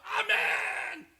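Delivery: high, drawn-out shouted amen; loud amen. Acted. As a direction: answer with a long ringing amen.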